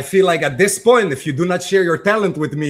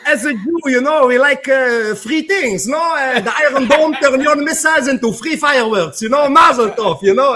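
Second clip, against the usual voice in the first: put-on Israeli accent; 5 semitones higher than usual. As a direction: ham up a rough Israeli accent. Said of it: "The accent needs work".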